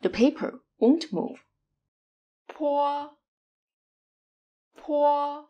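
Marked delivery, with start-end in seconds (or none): 2.5-5.4 "po" with a strong puff of air on the p; breathy aspirated "po". Shown as a demonstration. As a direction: blow air out on "po". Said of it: "the aspirated sound".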